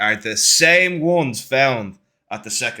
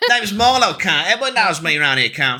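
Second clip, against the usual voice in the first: shady seller; 4 semitones higher than usual. Acted. GM as potion seller, brash and cocky. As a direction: puff up like a dodgy market trader.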